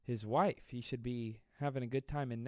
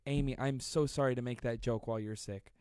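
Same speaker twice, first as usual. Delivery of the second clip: ordinary talk, nothing marked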